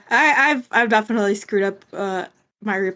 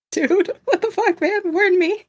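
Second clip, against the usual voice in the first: mock startled protest; 10 semitones higher than usual. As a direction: act out being caught off guard, pitched up. Acted startled protest to Ward.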